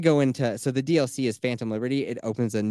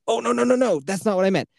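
much higher, alarmed no-no-no; panicked backpedal; 9 semitones higher than usual. Retold or imitated. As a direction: scramble to take it back, flustered. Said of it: "And I was like".

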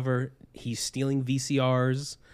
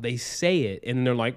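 usual speaking voice

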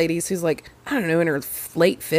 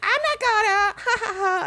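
high giggling reply; mock giggly girl. Imitating the girl answering the driver, giggly. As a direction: giggle through it, pitched up.

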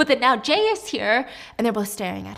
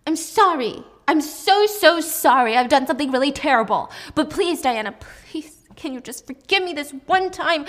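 tearful pleading; 5 semitones higher, 6 dB louder than usual. Acted. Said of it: "Mina gets up crying".

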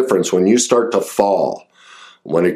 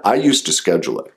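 ordinary talk

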